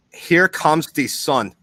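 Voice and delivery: very slow, spelled-out here comes the sun; slow English as German. Shown as a demonstration. Asked for German, he slowly says an English line. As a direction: draw each word out as if it were German. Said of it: "I'm gonna say it in German".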